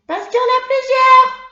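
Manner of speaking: very high, piercingly loud little voice; high-pitched classroom shout; shouting puppet pupil. Pretend. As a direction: yell the answer out like an overkeen pupil. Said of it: "tu n'as pas besoin de crier comme ça".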